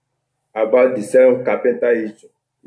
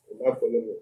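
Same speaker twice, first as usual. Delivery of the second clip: speaking away from the microphone; words drifting off the mic; 11 dB quieter, faster than usual. Real, not acted. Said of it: "Use the microphone properly".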